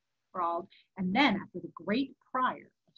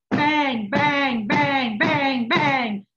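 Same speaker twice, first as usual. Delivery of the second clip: loud, higher repeated bangs; frantic knocking; 5 semitones higher, 10 dB louder, much slower than usual. A narrator's reading. Narrator voices knocking at the door, frantic and loud. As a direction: punch out each bang like pounding on a door. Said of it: "Knocking was more frantic than ever".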